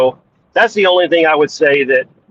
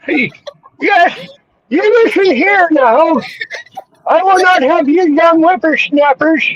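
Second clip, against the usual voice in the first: put-on high, quavering cranky old man; toothless; 12 semitones higher than usual. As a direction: scold like a toothless grandpa. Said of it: "That is so great".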